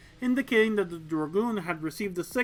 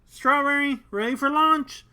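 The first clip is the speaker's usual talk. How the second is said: radio call; 7 semitones higher, 6 dB louder than usual. Acted. Susie confirms over radio.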